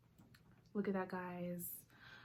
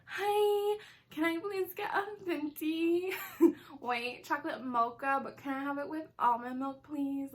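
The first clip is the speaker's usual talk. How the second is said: high, sugary drive-thru order voice; annoying ordering voice; 5 semitones higher than usual. Retold or imitated. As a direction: put on a squeaky overly polite voice. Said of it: "I feel like I sound so freaking annoying on the loudspeaker".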